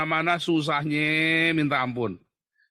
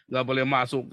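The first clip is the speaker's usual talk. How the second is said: usual speaking voice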